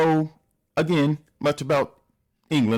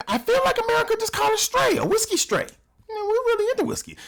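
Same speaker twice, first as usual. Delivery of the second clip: mock offended high voice; faster than usual. Real, not acted.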